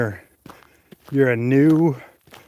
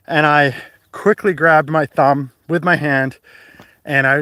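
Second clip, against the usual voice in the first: usual speaking voice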